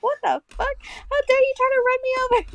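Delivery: very high, squeaky mock outrage; imitated indignant colleague. Telling how a colleague yelled at the driver, high-pitched mock indignation. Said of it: "She was like".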